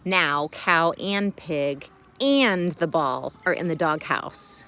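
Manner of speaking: ordinary delivery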